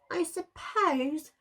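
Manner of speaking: high, slow sulky mutter with a huff; huffy mutter. A narrator's reading. Wilf muttering to the pack with a huff and a grunt. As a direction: grumble it like a sulking pup. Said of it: "muttered Wilf with a huff".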